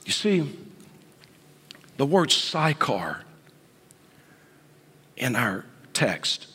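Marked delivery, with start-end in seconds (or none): none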